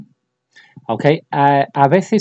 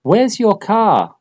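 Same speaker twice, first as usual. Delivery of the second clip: slow model question; 9 semitones higher, 3 dB louder, slower than usual. Shown as a demonstration. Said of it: "teacher models question".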